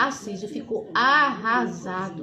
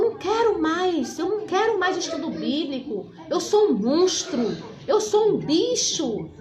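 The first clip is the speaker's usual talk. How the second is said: anguished refusal; 6 semitones higher than usual. Retold or imitated. Son refusing Bible study, despairing and anguished. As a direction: push the words out in anguish.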